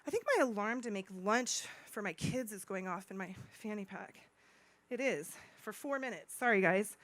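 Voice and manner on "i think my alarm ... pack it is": usual speaking voice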